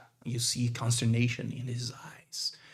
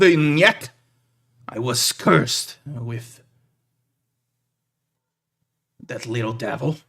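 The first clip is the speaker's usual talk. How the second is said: wry grumble; 9 dB louder than usual. Acted. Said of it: "the big man goes".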